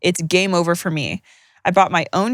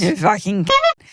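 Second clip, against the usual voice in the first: mock drunken slur; slower than usual. Slurring back at the cohost like the drunk nan. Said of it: "I'm slurring".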